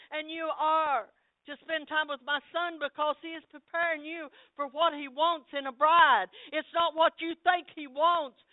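loud, high, commanding prophetic voice; voice of God. Acted. God calls his church to holiness, loud and commanding. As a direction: proclaim as the Lord himself. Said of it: "God is saying".

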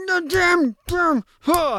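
high, hammed-up exasperated cursing; mock angry outburst. Bad guy finds his brother down and wants revenge, mock angry outburst. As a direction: curse in a high, theatrical fit. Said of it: "he's getting really angry".

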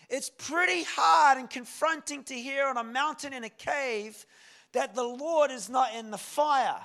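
loud, high, ringing sermon delivery; thundering preacher, hammering each phrase; full-force preaching. Real, not acted.

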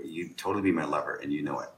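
quiet, matter-of-fact confidence; unbothered, soft-spoken claim; calm, understated certainty. Shown as a demonstration. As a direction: say it softly like it's obvious. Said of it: "I'm saying it like this".

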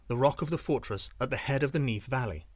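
plain reading voice

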